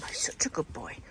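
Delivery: hushed praise for the dog; soft unvoiced whisper. Real, not acted.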